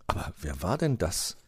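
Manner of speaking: plain reading voice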